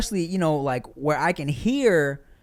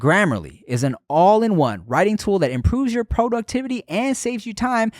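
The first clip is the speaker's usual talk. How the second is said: upbeat, higher-pitched podcast sponsor read; 4 semitones higher than usual. Acted. Host pitching Grammarly to students heading back to school, ad read.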